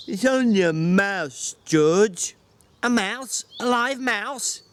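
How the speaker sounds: put-on slow, childlike excuse; Lenny defending himself to George; Lenny, slow and pleading. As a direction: plead innocence like a caught child. Lenny confesses the mouse.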